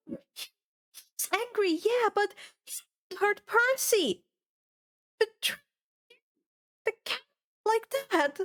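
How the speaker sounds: put-on high, tense girl's voice; hurt and fierce, defending Percy. Annabeth to Dionysus and Kieran, high and fierce.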